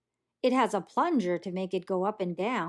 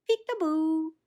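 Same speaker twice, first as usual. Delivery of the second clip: put-on squealing baby; 7 semitones higher, 3 dB louder than usual. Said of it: "squeals the baby".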